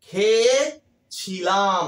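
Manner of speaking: "খেয়েছিলাম" stretched very slowly, each syllable held; drawn-out demo. Shown as a demonstration. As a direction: show the ending by dragging the word out. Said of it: "তখন কি বলছি?". ("খেয়েছিলাম" শব্দটা খুব টেনে টেনে বলা)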